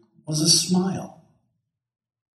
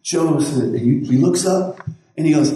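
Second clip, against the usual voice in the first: ordinary talk, nothing marked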